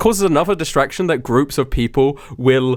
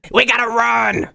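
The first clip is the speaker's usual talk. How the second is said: higher, drawn-out cry; urgent call to run; 9 semitones higher, slower than usual. Acted. Pocket telling Wanda to run, urgent drawn-out cry. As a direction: stretch out an urgent call to flee.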